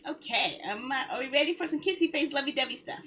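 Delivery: ordinary talk, nothing marked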